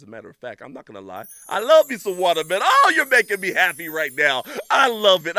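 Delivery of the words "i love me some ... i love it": delighted. Real, not acted. Delighted by watermelon.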